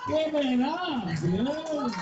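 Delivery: ordinary talk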